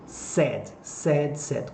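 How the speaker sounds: "said" like "sed", each one set apart; pronunciation of said. Shown as a demonstration. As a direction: model "said" as one short-vowel syllable, rhyming with "red". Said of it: "olha atenção pra pronúncia".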